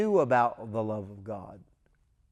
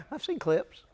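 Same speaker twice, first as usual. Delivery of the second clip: laughing; 12 semitones higher than usual. Real, not acted.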